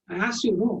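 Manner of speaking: high, put-on voice of a cross doorkeeper; scolding doorkeeper. Doorkeeper scolding the visitor at the door in a high voice.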